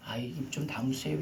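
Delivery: meek whispered plea; timid whisper. Shown as a demonstration. Wrong way to speak to demons, a meek whisper. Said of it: "이게 아니에요".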